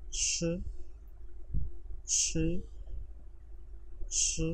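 श said as a light Chinese sh, with the r barely vibrated; sound drill. Shown as a demonstration. Teacher drilling a pinyin sound for students, slow and clear. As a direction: repeat श clearly, one syllable at a time. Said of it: "repeat करें मेरे साथ".

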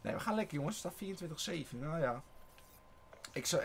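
ordinary delivery

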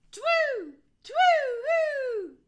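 loud, high, long hoots; ringing falling owl calls; owl hooting. A narrator's reading. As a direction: hoot out across the woods. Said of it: "hooted Albert".

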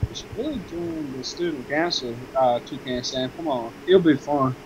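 barely audible mumbled read; too quiet. Acted. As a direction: say it under your breath. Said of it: "Hey, you didn't read it enough. I can't hear".

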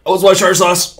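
loud frustrated yell; angry. Real, not acted. Angry at a game death.

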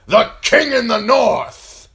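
slow, high, roaring acclamation; full-throated rallying cry; thundering shout. A narrator's reading. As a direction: thunder out the new title. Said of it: "he thundered".

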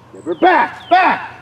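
high, loud field command; calling out to a dog. Real, not acted. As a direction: call the dog out from a distance.

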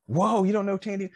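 high, loud disbelieving whoa; can't believe the friend doesn't know her; surprised. Real, not acted. Host teasing his co-host, loud surprised disbelief.

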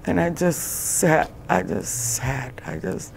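real grief, faltering into fragments; tearful. Real, not acted. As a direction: voice rising high, close to breaking. Recounts learning of son's death.

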